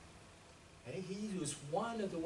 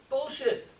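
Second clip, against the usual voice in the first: loud, high-pitched rejection; forceful, raised-voice rebuttal; emphatic dismissal; 8 semitones higher, 9 dB louder than usual. Real, not acted. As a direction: call it out loudly as nonsense. Rebuts the commission's quoted finding.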